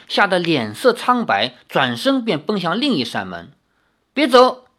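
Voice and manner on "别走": loud, high shout after someone; calling out. Acted. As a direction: call out to stop her running. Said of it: "李逍遥叫道". (大声喊住对方)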